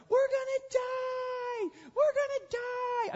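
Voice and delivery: hammed-up schoolgirl panic; panicked shriek. Preacher mocking the disciples in the storm, screaming in fake panic. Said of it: "like a bunch of schoolgirls".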